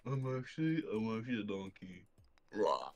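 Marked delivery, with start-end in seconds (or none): 2.5-2.9 low growly rawr; dinosaur voice. Pretend. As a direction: roar like a dinosaur. Playful animal roar in banter.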